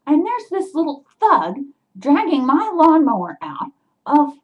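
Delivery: high, loud outraged retelling; worked-up, incredulous storytelling; indignant. Retold or imitated. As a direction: relive the outrage, voice jumping up. Reliving the break-in indignantly.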